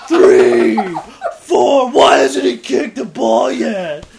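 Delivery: very loud count into drawn-out protest; mock outraged yell. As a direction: parody a fan timing the goalkeeper. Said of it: "I yelled that really loud".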